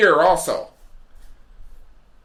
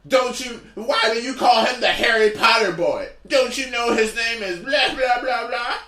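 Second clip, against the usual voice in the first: higher, fussy fan voice; scolding fan; 6 semitones higher than usual. Retold or imitated. Fan lecturing the comic about the actor's name, in a higher fussy voice. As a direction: nag him like a superfan.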